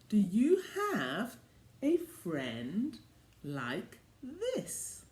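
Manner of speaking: plain reading voice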